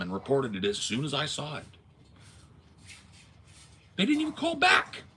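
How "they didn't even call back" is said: loud. Retold or imitated. Angry retelling to landlord.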